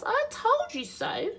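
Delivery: very high mouse voice; smug mouse. Acted. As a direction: gloat in a tiny high voice. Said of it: "said Mouse".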